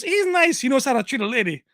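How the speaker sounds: high, loud mocking woman's voice; imitating the sister. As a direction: mimic her in a high voice, mocking. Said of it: "she goes".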